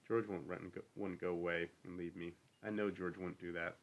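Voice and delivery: ordinary delivery, nothing marked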